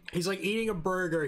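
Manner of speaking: ordinary delivery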